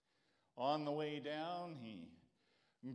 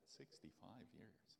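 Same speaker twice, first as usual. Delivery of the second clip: quiet disbelief; 7 semitones lower, 19 dB quieter than usual. Real, not acted. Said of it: "can't believe that".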